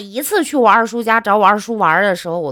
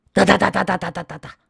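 fast mock "哒哒哒" babble; rapid mock chatter; faster than usual. Nephew mimics his own endless nagging with a fast "哒哒哒". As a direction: rattle off "哒哒哒" like nonstop nagging.

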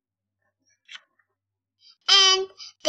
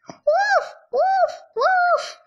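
high yappy little woofs; high dog barks; slower than usual. Acted. Paul the dog barking back at Arthur, high yappy woofs. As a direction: bark like an eager puppy.

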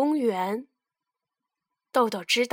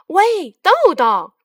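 calling out; 6 semitones higher, 6 dB louder, much slower than usual. Acted. Shakes packet, calls beans.

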